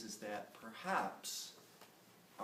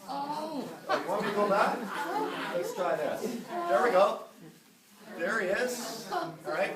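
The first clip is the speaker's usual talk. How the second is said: laughing; 10 semitones higher, 11 dB louder than usual. Real, not acted. Laughing while fixing slides.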